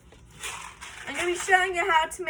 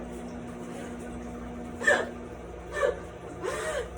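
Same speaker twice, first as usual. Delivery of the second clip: breathy laugh; 12 semitones higher, 5 dB quieter than usual. Real, not acted. Laughs at own drama.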